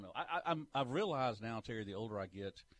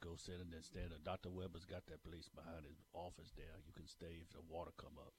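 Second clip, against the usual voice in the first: low, quiet Cajun coach impression; Ed Orgeron; 7 semitones lower, 12 dB quieter than usual. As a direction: keep it down in a put-on Cajun brogue. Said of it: "fake Ed Orgeron".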